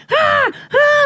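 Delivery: shrill-pitched imitation of a parrot cry; high parrot squawk. Brother's neighbour parrots squawking outside, high parrot squawk. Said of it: "they're like".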